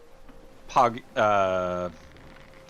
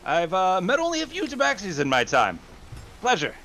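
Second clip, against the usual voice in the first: character voice; 7 semitones higher than usual. Acted. Character voice, NPC greeting.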